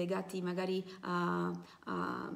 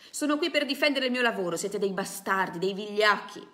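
much louder, furious shouting insults; yelling; 2 semitones higher, 8 dB louder than usual. Acted. Protester screaming at the police and crowd, furious loud shout. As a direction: scream it at the crowd beating him. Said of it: "Il tipo continuava ad urlare".

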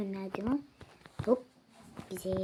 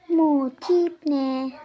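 bu tip ne drawn out much louder; loud slow outburst; 2 semitones higher, 8 dB louder, much slower than usual. Acted. Mocking a customer's look, loud and drawn out. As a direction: blast Bu tip ne, stretching each word.

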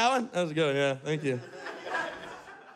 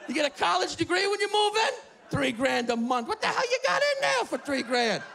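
much higher, shouting rant, laughing at times; old New Yorker ranting; 9 semitones higher than usual. Retold or imitated. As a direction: keep yelling at the trees about the rent. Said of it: "He's just shouting it".

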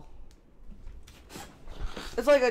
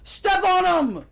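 loud; 9 semitones higher, 5 dB louder, slower than usual. Acted. Loud slow game shout.

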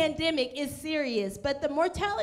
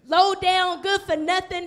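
mock insult; 3 semitones higher, 5 dB louder than usual. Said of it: "He didn't say".

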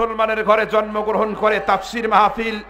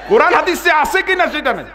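fast, rising angry shout at the crowd; scolding shout; 9 semitones higher than usual. Real, not acted. Preacher scolding the silent crowd, rising shout.

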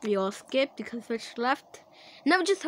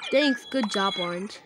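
usual speaking voice

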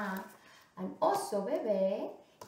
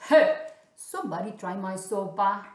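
loud, indignant papa bear; 9 dB louder than usual. Acted. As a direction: call out as the big father bear. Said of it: "also papa said".

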